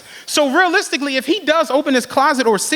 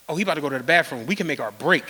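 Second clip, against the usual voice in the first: hushed hiding; 9 semitones lower, 6 dB quieter, much faster than usual. Retold or imitated. Whispering while hiding in closet.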